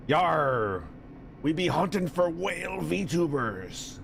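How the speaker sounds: slow hammed-up pirate voice. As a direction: play a jolly pirate, slowly. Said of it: "Why am I speaking like a pirate?".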